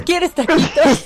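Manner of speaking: sing-song motherly offer; doting mother. Retold or imitated. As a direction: coo it like a doting mom. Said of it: "atenta a ver qué voy a comer".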